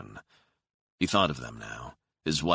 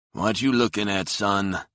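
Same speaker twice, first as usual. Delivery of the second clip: put-on louder, higher, a confrontational old man; hostile challenge; 4 semitones higher, 5 dB louder, slower than usual. Old homeless man confronting Nathan, hostile and loud. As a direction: square up to the stranger.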